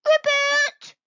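very high, loud failed ribbit; a tadpole tries his first ribbit; squeaky ribbit. A narrator's reading. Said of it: "opened his mouth and".

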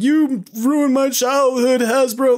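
high, slow, hammed-up wail of betrayal; mock outraged fan. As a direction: overplay a wounded fan crying out to the world. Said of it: "to the world to be like".

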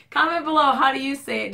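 ordinary talk, nothing marked

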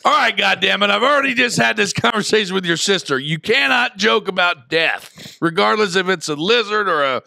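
high, loud fed-up rant; exasperated dad. Retold or imitated. Father retells scolding his kids about joking over death, loud exasperated. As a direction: scold the kids, losing patience. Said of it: "And I'm like".

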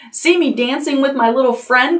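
high, lilting call-out; gleeful cry. A narrator's reading. Pippi riding the whirling bull, crying out gleefully. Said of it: "cried Pippi".

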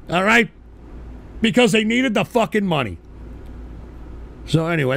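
normal talking voice

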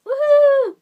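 loud, high whoop of joy; excited cheer. Real, not acted. Cheering the candy review start.

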